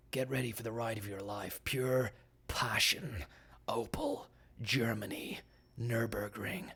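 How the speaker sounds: hushed, slow dramatic trailer read fading to a whisper. Acted. Host closes the show, hushed trailer whisper. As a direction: tease it like a movie trailer.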